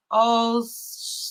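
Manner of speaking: Oceania drawn out as "ohs... see"; sounding it out. Real, not acted. Streamer attempting a word without phonics, sounding it out. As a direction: stretch the first sounds of Oceania slowly, piece by piece. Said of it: "Let me try to say this".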